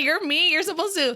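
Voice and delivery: high playful whine; mock whine. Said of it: "I'm like".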